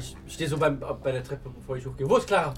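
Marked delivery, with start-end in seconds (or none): 2.1-2.5 shouting up the stairs; calling out. Acted. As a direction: call her name loudly. Louder repeat of call.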